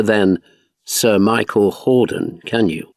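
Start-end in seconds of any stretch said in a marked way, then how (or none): none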